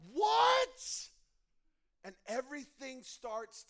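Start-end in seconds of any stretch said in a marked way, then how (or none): 0.1-1.1 loud, much higher cry of disbelief; shocked what. Retold or imitated. Listener hearing the big announcement, loud stunned cry. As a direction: blurt it out stunned. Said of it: "you're like".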